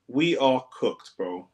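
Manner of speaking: slow, defeated drawl; slow drawn-out despair. Real, not acted. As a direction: stretch each word out, beaten. Repeats slowly after louder take.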